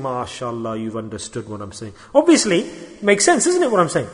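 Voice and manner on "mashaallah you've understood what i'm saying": much softer and lower, gently pleased; soft, lower approving aside to the audience. Real, not acted. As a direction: murmur your approval to the room.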